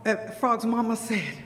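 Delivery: ordinary delivery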